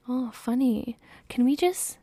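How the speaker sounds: ordinary talk, nothing marked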